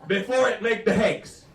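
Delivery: emphatic French Canadian mother; French Canadian accent. Retold or imitated. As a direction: finish the mangled saying in French Canadian English. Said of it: "my mother's like".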